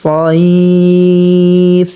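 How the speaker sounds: very slow, drawn-out tilawat chant; slow melodic Quranic recitation. Shown as a demonstration. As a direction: recite each word with long held vowels. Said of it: "যেমন".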